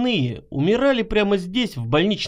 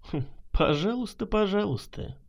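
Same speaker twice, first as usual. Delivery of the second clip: soft, amused brush-off; smirking; 6 dB quieter than usual. A narrator's reading. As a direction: shrug it off with a quiet smirk. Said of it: "усмехнулся Кошкин".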